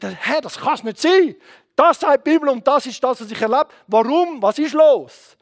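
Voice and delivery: loud, high pleading to God; anguished protest. Retold or imitated. Preacher retelling his grieving prayer to God, protesting. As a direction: demand answers from heaven. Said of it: "ich habe nicht nur einen Tag gebrüllt".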